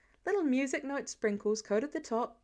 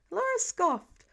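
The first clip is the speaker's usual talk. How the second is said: plain reading voice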